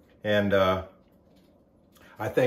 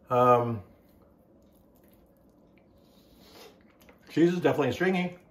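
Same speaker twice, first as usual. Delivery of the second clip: speaking between bites; talking while chewing. Real, not acted. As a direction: mouth partly full. Seen 1: Bit pizza at -1.0; mouth shows chewing while speaking early.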